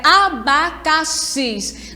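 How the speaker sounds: "abacaxis" broken slowly into a-ba-ca-xis; syllable by syllable. Shown as a demonstration. Teacher shows pupils the syllables, "abacaxis" split syllable by syllable. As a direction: say "abacaxis" one syllable at a time. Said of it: "Já está separadinha em sílabas".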